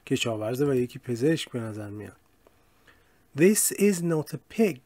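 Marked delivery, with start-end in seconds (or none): 3.4-4.8 very slow, carefully spaced English; deliberate classroom model reading; slow model sentence. Shown as a demonstration. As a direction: read it out slowly for learners to copy. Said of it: "teacher models example sentence".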